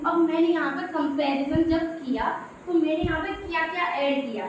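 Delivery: usual speaking voice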